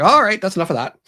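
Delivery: high, loud telling-off; acted scolding. Woman to raven picking at body, scolding loudly. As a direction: scold a pesky bird sharply.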